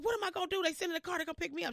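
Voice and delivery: high, fast, breathless worry; excited panic. Retold or imitated. Telling mom a car is coming, fast and high. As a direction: rush it out in a thrilled panic.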